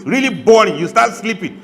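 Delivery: mock outrage at dull imams; emphatic jab. Real, not acted. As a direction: really lean into the word boring.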